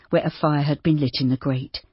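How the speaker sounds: usual speaking voice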